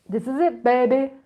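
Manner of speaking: hammed-up reveal. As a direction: sell it like a triumphant showman.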